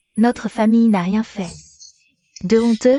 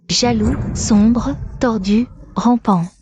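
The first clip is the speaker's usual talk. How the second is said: slow listing; much slower than usual. Acted. Énumère lentement les défauts.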